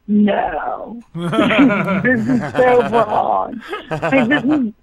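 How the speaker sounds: mock horror, laughing; laughing disbelief. Reading a twisted script, laughing in disbelief. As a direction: laugh through the dismay. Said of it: "I was like".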